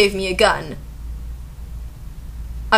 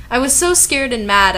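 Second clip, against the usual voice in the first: ordinary delivery, nothing marked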